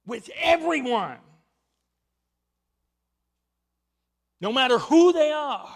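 loud, rising pulpit proclamation; a thundering sermon climax; fervent preaching. Real, not acted. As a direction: preach it out with full conviction. Preaching at full force.

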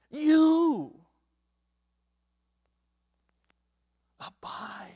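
"You" loud and high, then "abide" whispered; emphatic command. Shown as a demonstration. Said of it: "that word abide is ... a command".

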